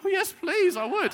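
very high, loud gushing reply; eager mock acceptance. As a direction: squeal a keen yes as the retold cashier. Third cashier eagerly accepts, acted out.

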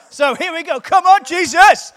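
loud, high rallying cry; rousing shout. Real, not acted. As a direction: shout it to fire up the crowd. Rallying the audience before the message.

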